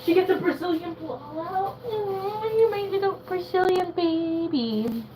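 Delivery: put-on baby talk. Cooing to the dog.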